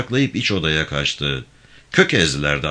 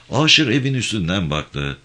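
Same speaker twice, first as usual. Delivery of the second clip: ordinary delivery, nothing marked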